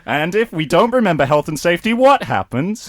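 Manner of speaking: upbeat cartoon voice for kids; cartoon host. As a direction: sell it like a kids' show presenter. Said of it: "Saturday morning cartoon".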